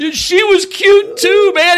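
high, loud and slow, awkward flattery; sheepish backpedal. Retold or imitated. Comic complimenting the angry husband's wife, high sheepish squeak. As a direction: squeak it out, trying to make it better. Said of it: "I had to be all like".

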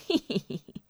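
soft, sinister snicker; sly villain's cackle. A narrator's reading. Villain gloating over his surrounded enemies, sly cackle. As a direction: snicker slyly under the breath. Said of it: "许仁一阵奸笑".

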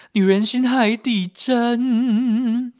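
very high, slow, drawn-out wail; dramatic wail. Pretend. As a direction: wail the proverb like a stage lament. Dramatic proverb exclamation. (高亢拖长地哀叹)

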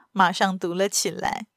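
ordinary delivery, nothing marked

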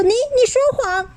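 put-on high childish accusation; the other babies accusing Xiaolu, indignant; accusing kids. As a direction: call out the liar. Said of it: "四只都这样说".

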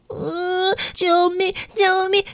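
put-on a scared girl begging inside; hint of a crying child; silent cry for help. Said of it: "苏丽这个时候在心里想说".

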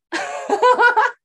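very high burst of laughter; a high, loud squeal of laughter. Real, not acted. Telling her cohost about a stranger in the living room, squealing laugh.

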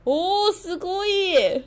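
slow, high admiring すごい; impressed tourist. Retold or imitated. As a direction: gush slowly like an amazed Japanese student. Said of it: "然后就说".

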